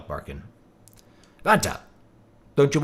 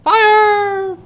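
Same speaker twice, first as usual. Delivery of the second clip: much higher, louder cry of fire; alarmed shout; 5 dB louder than usual. Acted. Villager raising the alarm, "Fire!" shouted much higher.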